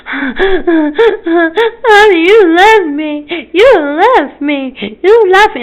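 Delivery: put-on high, loud, drawn-out sobbing kid; imitated child's panicked crying; child's panic attack. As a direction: wail slowly like an abandoned child. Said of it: "having a panic attack behind this pole, going".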